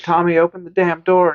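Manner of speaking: frantic demand. Retold or imitated. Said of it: "And she's like".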